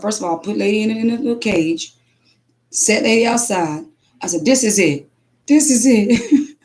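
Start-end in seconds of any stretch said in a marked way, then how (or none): 4.4-6.6 higher, louder stern scold at the dog; a slow, high warning, hammed up; retold scolding of the dog; mock stern scolding. Scolding the dog, acted quote.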